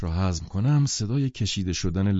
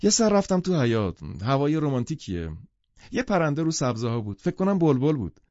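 put-on higher, lilting young woman; lightly voiced Daisy; 6 semitones higher than usual. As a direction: gush about the garden. (صدای زیرتر و پرشور دیزی)

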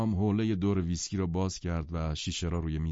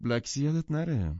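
higher-pitched word of warning; 7 semitones higher than usual. A narrator's reading. Jordan reminding Daisy of Biloxi, in warning. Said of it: "به او هشدار داد".

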